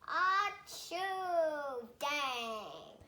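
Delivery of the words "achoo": child's playful acted achoo; pretend sneeze. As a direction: fake a big bear sneeze. Child acts bear's sneeze.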